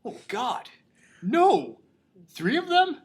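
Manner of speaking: high-pitched mock disbelief; squeaky, halting shock, pausing between phrases; Ronnie. Host acts Ronnie hearing of the murders, high stunned disbelief. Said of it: "he's like".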